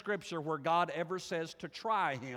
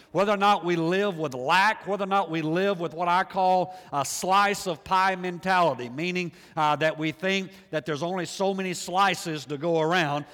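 loud, driving pulpit preaching; preaching at full force; 7 dB louder than usual. Acted. Pastor rousing his congregation, preaching loudly. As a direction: preach it out to the whole room.

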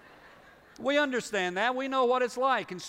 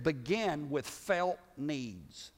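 very slow, softer key line; slow emphasis; 3 semitones lower, 4 dB quieter, much slower than usual. Acted. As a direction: lay each word down slowly. Preacher slows for key point.

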